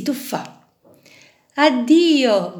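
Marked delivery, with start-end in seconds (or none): 1.6-2.6 louder cry of farewell; shouted farewell. A narrator's reading. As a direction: call goodbye out loud. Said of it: "gridò alle compagne".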